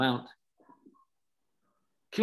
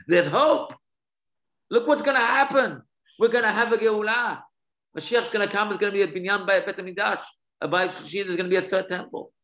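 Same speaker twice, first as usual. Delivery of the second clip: rising excitement; 8 semitones higher, 4 dB louder than usual. Acted. Akiva voiced proclaiming hope.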